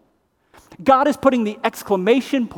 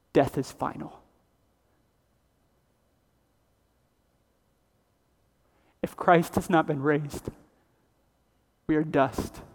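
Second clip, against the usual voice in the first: grave quiet preaching; 4 semitones lower, 8 dB quieter than usual. Real, not acted. Preacher to congregation, hushed and grave. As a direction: let each line fall soft and final.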